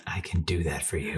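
deep, soft and suggestive; low seductive repeat. Real, not acted. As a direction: drop it low and smooth. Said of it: "repeated lower, softer".

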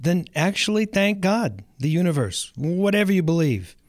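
ordinary talk, nothing marked

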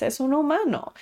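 normal talking voice

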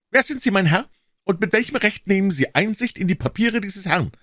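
put-on high, loud, sharp old voice; indignant old man. A narrator's reading. Old man confronting Holmes over the papers, high and indignant. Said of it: "fragte er meinen Freund".

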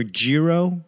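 "gyro" said as JEE-roh; "gyro" starting like the word "jeep"; pronunciation variant. Shown as a demonstration. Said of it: "however the fuck you pronounce it".

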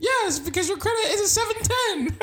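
very high, laughing squeak; high squeaky voice. Retold or imitated. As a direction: answer back like a giggling munchkin. Answers in the squeaky voice.

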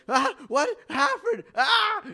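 high, loud panicked yell; panicked screaming. Acted. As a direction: scream in shock at the bleeding splinter. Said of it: "They are freaking out, like".

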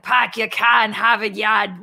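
exaggerated Boston accent with dropped R's; mock the Hollywood take on Boston speech; movie Boston accent. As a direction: lay on a thick Boston accent. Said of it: "We don't talk like that".

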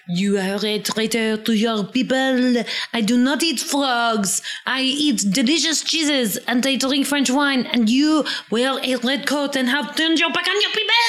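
high, hammed-up French accent; Fergus. Fergus telling off the redcoat, thick mock French accent. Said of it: "in his little tiny French actor accent".

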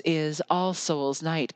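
ordinary talk, nothing marked

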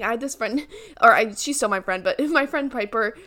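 usual speaking voice

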